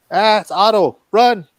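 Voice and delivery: usual speaking voice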